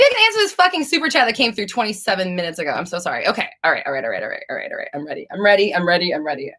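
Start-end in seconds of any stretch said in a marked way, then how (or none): none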